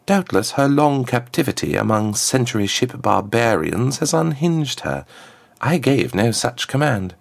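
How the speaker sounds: high, smooth voice of a formal diplomat; a smooth ambassador, pitched well up; smooth contralto ambassador. A narrator's reading. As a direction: address royalty smoothly in a high-set voice. Plays the ambassador.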